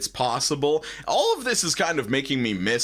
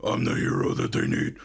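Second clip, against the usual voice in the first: much lower gravelly growl; Batman voice. Retold or imitated. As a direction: growl it like a brooding vigilante. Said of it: "he's doing the Batman voice".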